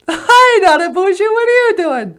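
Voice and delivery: very high, loud squeaky alien. Acted. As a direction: greet him like a tiny cartoon alien.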